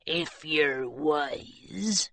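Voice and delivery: low, very slow quiet warning; softly threatening pirate; menacing pirate. Acted. Pirate warns Hilary.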